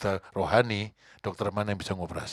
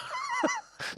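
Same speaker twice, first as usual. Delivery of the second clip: high laugh; 6 dB quieter than usual. Real, not acted. Laugh mid sermon.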